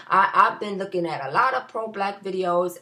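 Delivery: ordinary talk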